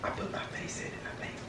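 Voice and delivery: hushed whisper, barely voiced. Real, not acted. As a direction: whisper so you're not heard. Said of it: "Why are you whispering".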